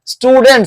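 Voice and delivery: स्टूडेंट्स ending in an unvoiced "ts"; final s sound. Shown as a demonstration. Teacher models the ending after T, final s sound. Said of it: "यहाँ पे pronounce करेंगे आप सौ".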